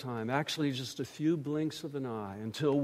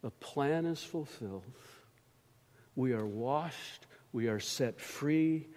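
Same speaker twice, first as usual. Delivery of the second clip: ordinary talk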